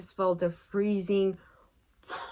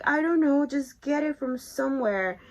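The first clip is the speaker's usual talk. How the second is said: exasperated; 7 semitones higher, 3 dB louder than usual. Retold or imitated. Snapping back at partner's question.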